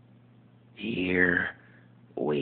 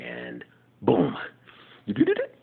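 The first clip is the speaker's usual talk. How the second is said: normal talking voice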